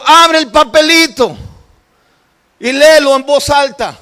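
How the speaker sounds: loud, high urging; retold shouted order. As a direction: call out instructions from afar. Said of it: "él está gritando del hoyo de enfrente".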